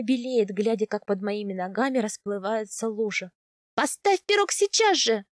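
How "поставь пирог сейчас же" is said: high, urgent bark; raised-pitch command, moderately forceful; barked order. A narrator's reading. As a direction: snap out an order in alarm. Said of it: "рявкаю я".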